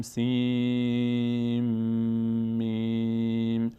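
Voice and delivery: سین flowing into میم, both held very slowly; merged letter names. Shown as a demonstration. Teacher showing the nun assimilation, slow and drawn out. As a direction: join سین into میم with a long hum.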